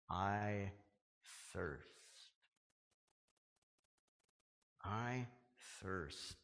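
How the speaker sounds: whisper-near and spent; parched words. Retold or imitated. Jesus on the cross, faint parched plea.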